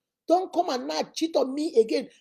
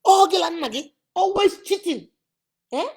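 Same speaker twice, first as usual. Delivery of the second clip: loud and high, fed-up rant; mother venting about her cheating husband, loud; angry rant; 6 semitones higher, 8 dB louder, slower than usual. Retold or imitated. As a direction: shout it out like a furious wife.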